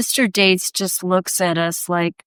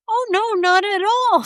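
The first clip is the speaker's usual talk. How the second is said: very high, airy retold reply; breezy innocent assurance; 11 semitones higher than usual. Artist retelling her answer to the policeman, breezily obliging.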